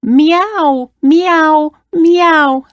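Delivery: high meows falling at the end; screeching cat. A narrator's reading. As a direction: imitate an upset cat. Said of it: "screeched the cat".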